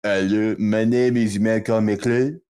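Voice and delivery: slow, hammed-up French accent; mock French introduction, words drawn out. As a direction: put on a thick comic French accent. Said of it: "giving Malcolm a French accent".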